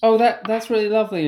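gushing in a put-on English accent; attempted English accent. Coworker imitating an English colleague to her face, attempted English accent. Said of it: "speak back to her in our, like, attempted".

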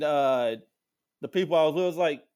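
ordinary delivery

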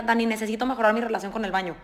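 high, fast, hammed-up pleading over the laughter; mock desperate patient. Patient pleading with the nutritionist about the bathroom, high and frantic. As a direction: play a frantic patient begging for help, rushing.

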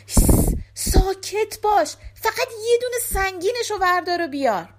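loud hiss, then high scolding; urgent shushing. Acted. Thieves hush Giske at henhouse.

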